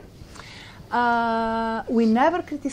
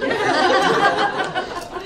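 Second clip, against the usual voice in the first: laugh; 12 semitones higher, 6 dB louder than usual. Real, not acted. Laughing at her story.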